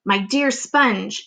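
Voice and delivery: slow, sly drawl; Aunt Spiker scheming with her sister; slowly. Acted. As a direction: draw it out slyly. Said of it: "Aunt Spiker said slowly".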